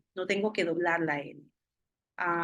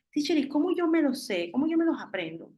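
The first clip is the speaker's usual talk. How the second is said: higher-pitched pleading student voice; student's question; 7 semitones higher, 3 dB louder, faster than usual. Acted. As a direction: play a student asking the teacher, pitched up. (voz aguda de alumno preguntando)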